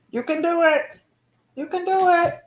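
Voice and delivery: high cheering you can do it; high-pitched pet encouragement; encouraging. Real, not acted. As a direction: cheer the dog on. Encouraging the dog.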